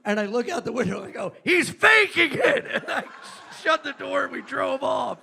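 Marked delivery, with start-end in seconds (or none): 1.4-2.7 high, loud, drawn-out holler; outraged exposing shout. Retold or imitated. Comic in a cab to the helpers, hollering. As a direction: holler the accusation to passers-by.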